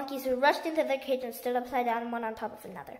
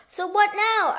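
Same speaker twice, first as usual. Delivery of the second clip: loud; 8 semitones higher, 6 dB louder, slower than usual. A narrator's reading. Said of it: "asked the Roly-Poly Bird".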